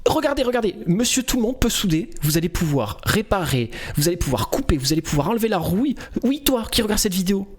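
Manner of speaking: hyped-up video creator intro; rally the viewers with upbeat, rising energy; punchy sales patter climbing high in pitch; demo of lively voiceover. Shown as a demonstration. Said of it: "Je vais vous la faire".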